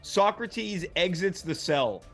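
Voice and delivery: ordinary talk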